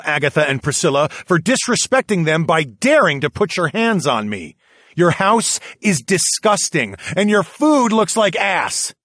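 loud, spitting furious insults; angry tirade. A narrator's reading. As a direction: tell them all off.

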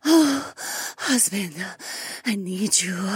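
high, needy whining plea; whined. A narrator's reading. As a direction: whine it, begging. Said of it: "she whined".

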